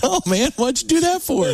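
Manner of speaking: high-pitched imitated complaint; mock exasperation. Retelling two comedians doing a podcast, mock exasperation.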